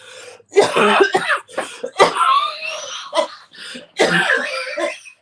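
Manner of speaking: harsh hacking coughing fit; smoker's cough. Real, not acted. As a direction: cough hard and raspy. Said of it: "This smoker's cough".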